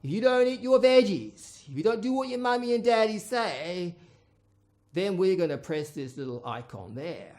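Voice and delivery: put-on a priest threatening a boy with hell; stern threatening priest. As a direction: threaten the child like a pantomime villain. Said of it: "the priest says".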